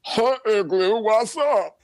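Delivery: high-pitched cartoon character voice; early Igloozers voice. Creator demonstrating his first cartoon's voice to the host, high put-on character voice. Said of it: "the voice is like".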